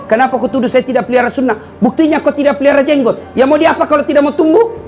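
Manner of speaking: laughing retort. Retold or imitated. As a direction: toss the retort back with a laugh. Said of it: "Saya bilang".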